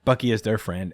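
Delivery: usual speaking voice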